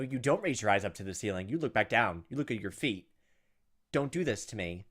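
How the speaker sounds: normal talking voice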